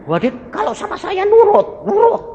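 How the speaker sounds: jauh lebih tinggi dan lebih keras, heran bercanda; seruan tinggi. Real, not acted. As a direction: lambungkan suara tinggi seperti takjub. Menggoda soal istri yang nurut. (pendeta bercerita soal istrinya, nada sangat tinggi)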